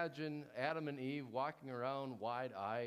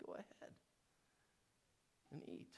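breathy whispered serpent urging; villainous whisper; 11 dB quieter than usual. Acted. Serpent whispering to Eve to eat, hushed. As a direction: whisper the final temptation. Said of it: "this villainous whisper".

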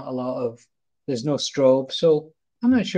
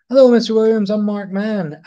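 bright, keyed-up nervous greeting; eager hello; 7 semitones higher, 9 dB louder than usual. Retold or imitated. Photographer greeting Robin Williams, loud and eager. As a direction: greet a star with a loud rush.

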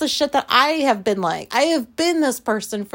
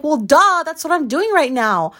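a louder, higher "duh" with some sass; sassy retort; 6 semitones higher, 6 dB louder than usual. Retold or imitated. Answering her wife who tells her to get her pillow, sassy and louder. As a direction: snap back with a mock-obvious "duh".

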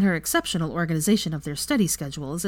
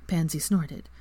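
plain reading voice

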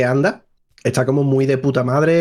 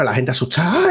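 normal talking voice

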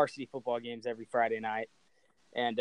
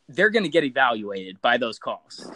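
ordinary delivery

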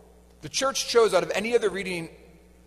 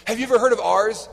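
louder, higher, insistent asking; retold eager question; 4 semitones higher, 7 dB louder than usual. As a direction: press them with excited hope.

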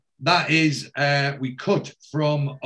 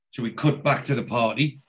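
ordinary talk, nothing marked

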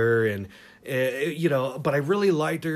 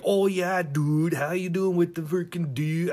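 higher-pitched mock Maine accent with surfer drawl; Maine-surfer accent mix; 5 semitones higher than usual. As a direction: imitate a New England surfer dude. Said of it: "imagine a northeastern Maine accent mixed with Southern California surfer drawl".

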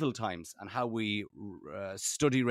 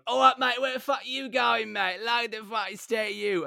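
screaming English tourist; 9 semitones higher than usual. Retold or imitated. English tourists heckling a skinny-dipper walking through Magaluf, screaming. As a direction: yell abuse like an English tourist abroad. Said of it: "English tourists screaming at you".

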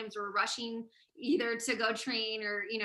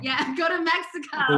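laughing; 6 semitones higher, 10 dB louder than usual. Real, not acted. Laughing echo of the joke.

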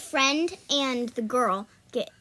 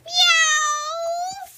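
high, loud drawn-out meow; playful cat imitation, pitched way up; 6 dB louder than usual. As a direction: let out a long, piercing cat cry.

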